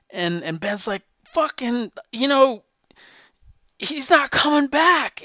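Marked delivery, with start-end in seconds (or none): 1.2-5.2 much higher, louder, cracking with grief in a retold line; anguished retort. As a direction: Ben throwing back his pain, voice pitched way up. Ben grieving, answering Torch.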